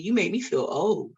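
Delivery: ordinary talk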